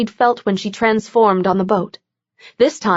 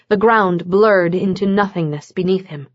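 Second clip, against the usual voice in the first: ordinary delivery, nothing marked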